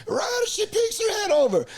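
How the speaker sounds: high hammed-up Irish lad voice; high mock Irish lad coaching. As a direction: explain it like a drunk Irish mate, high. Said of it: "'cause it's all cliffs".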